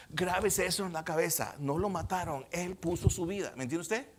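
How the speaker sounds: ordinary talk, nothing marked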